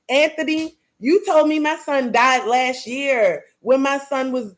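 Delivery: ordinary talk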